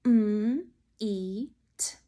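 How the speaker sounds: "M, E, T" as separate sounds, mmm, ee, t; sounded out. Shown as a demonstration. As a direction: say each sound of meet alone with pauses. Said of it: "How many sound chips did you use for meet?".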